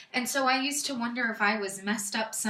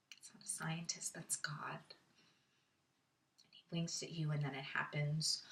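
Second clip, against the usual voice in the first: very soft and low, almost whispered; low, hushed confession; hushed realization; 7 semitones lower, 13 dB quieter than usual. Acted. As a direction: let the dread sink to a near whisper. Grim realization in the monologue.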